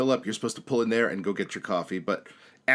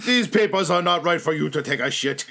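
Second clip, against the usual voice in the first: mock officer, louder and higher; stern official; 5 semitones higher, 5 dB louder than usual. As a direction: play a disapproving checkpoint official lightly. Acted official rejecting papers.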